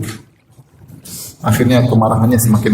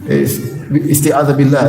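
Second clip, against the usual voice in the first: ordinary talk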